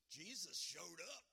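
loud, emphatic preacher's climax; excited proclamation. Real, not acted. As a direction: proclaim it loudly to the congregation.

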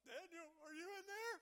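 worried king calling down into the lions' den; puny lamentable voice. Retold or imitated. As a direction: cry out to Daniel in a small, wailing king's voice. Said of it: "I think it's kind of a puny voice".

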